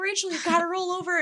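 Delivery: high, fast mom voice. Retold or imitated. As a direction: urge her up in mom's voice. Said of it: "She'd be like".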